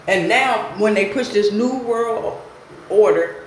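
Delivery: ordinary delivery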